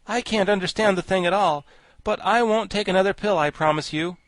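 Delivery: put-on high, loud boyish refusal; animated storybook boy in a sulk; Tip, cross and stubborn. A narrator's reading. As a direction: refuse huffily. Narrator voices sulky Tip.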